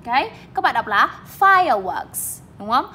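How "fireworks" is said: fireworks with FIRE raised, works falling; stress on first syllable. Shown as a demonstration. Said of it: "Fire thì các bạn lên giọng và works thì".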